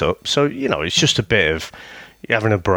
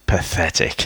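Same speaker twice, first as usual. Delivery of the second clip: ordinary talk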